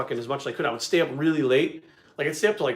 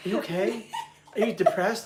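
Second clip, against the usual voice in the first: mock worried wife; 6 semitones higher than usual. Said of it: "she's like".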